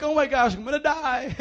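high, loud hammed-up terror; jokey high panic about drowning; mock panic. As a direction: shriek like a drowning kid. Kid panicking in deep water.

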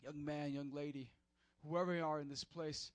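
ordinary talk

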